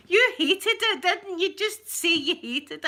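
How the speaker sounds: high, loud, playful accusation; teasing accusation. Retold or imitated. Teasing a coworker who clearly hated the haggis, high and loud. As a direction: catch him out, delighted.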